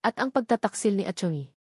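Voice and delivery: ordinary delivery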